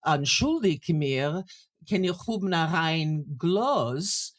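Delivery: put-on slow, careful Yiddish with a German accent. Singer asking a chambermaid to clean her glass, German-accented Yiddish. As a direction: lean into German pronunciation, drawn out. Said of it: "with a more German accent".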